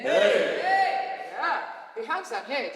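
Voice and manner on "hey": loud high calling hey; yell. Shown as a demonstration. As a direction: shout to someone. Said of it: "yell to someone".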